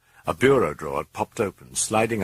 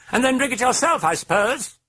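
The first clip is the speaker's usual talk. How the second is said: very high mocking British voice; high cheeky British taunt with a laugh; 4 dB louder than usual. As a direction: needle the priest, laughing. British voice sneers.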